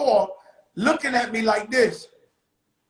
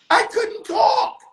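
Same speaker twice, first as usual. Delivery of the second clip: shouted punchline; 11 semitones higher, 4 dB louder, slower than usual. Acted.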